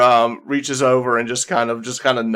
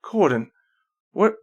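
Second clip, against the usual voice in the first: usual speaking voice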